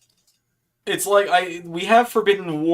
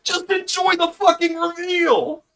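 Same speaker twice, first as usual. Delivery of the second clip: exasperated yell. Retold or imitated. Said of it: "I was like".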